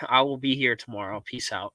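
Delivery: normal talking voice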